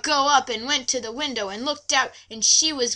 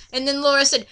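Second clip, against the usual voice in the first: usual speaking voice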